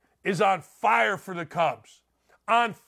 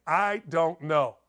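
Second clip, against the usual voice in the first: ordinary delivery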